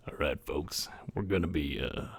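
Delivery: put-on deep, soft southern accent. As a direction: attempt a low southern host voice. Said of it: "It's such a hard voice to do".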